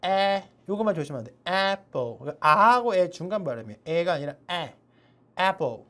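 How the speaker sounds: ordinary talk, nothing marked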